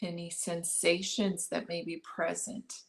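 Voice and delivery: calm meditation guide, long pauses between phrases; unhurried body-scan guidance, leaving space after each instruction; guided meditation. Acted. Guiding listeners to notice breath and body, guided meditation.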